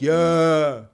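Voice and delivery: slow, loud, dopey mock agreement; mocking gullible people. Mocking gullible people who want the news, a loud dopey mock. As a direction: play the sheep who swallow it all. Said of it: "While people is like".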